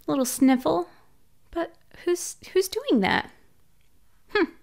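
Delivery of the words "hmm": normal talking voice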